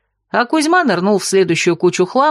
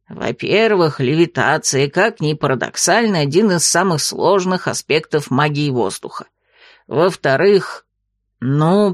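calm lecturing monster; 5 semitones lower than usual. A narrator's reading. Ghost lectures calmly.

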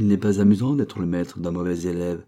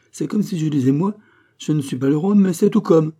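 mocking laughter; 7 semitones higher, 4 dB louder than usual. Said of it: "s'écria en riant le serrurier".

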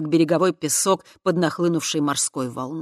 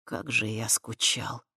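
lower, softer, slow and tender; inspired tenderness; 5 semitones lower, 6 dB quieter, slower than usual. A narrator's reading. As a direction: breathe it lovingly, enraptured. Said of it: "вдохновенно произнёс он".

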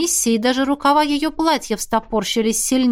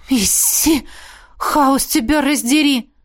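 startled gasp; 4 semitones higher, slower than usual. A narrator's reading. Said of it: "выдохнула Миранда".

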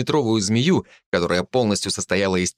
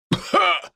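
choking cough. Acted. Dying man coughing.

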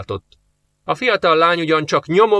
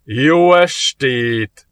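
slow, louder, drawn-out good evening; loud greeting; 4 dB louder, much slower than usual. A narrator's reading. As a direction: call it out grandly. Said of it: "köszönt hangosan". (hangos, elnyújtott köszönés)

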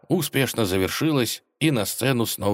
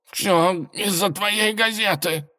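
hoarse broken voice; 13 semitones higher, slower than usual. Acted. Cat with lost voice.

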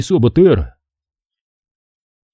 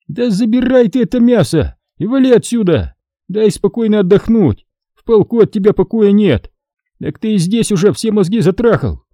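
a raised, exasperated tirade; Hasan worked up; 10 semitones higher than usual. A narrator's reading. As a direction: let it boil over. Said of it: "разошёлся Хасан".

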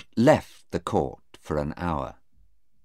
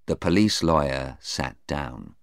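usual speaking voice

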